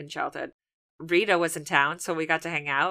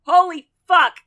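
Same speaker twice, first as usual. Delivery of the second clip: shocked exclamation; 12 semitones higher, 13 dB louder than usual. Retold or imitated. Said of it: "you're like".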